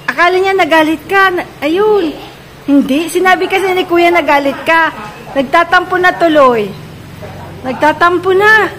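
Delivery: high, loud playful shouting; loud teasing call. Real, not acted. Teasing a family member about sulking, loud playful calls. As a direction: tease them loudly from afar.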